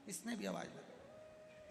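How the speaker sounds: usual speaking voice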